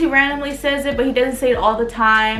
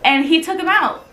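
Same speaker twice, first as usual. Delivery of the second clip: ordinary talk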